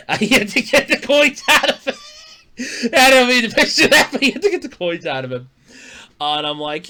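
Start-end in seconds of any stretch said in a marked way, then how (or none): 0.1-5.5 laughing. Real, not acted. Laughing at his coin joke.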